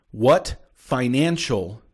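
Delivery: "financial" stretched out very slowly; stress on financial. Real, not acted. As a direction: separate "what" and "financial", each slow and weighty. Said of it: "I'm gonna stress the word financial".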